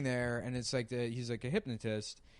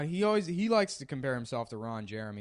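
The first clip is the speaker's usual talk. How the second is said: ordinary delivery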